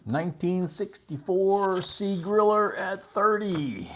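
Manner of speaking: high, loud, drawn-out auction chant; auctioneer's call. Real, not acted. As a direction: stretch each word out as you call the bid. Auctioneer calling item and bid.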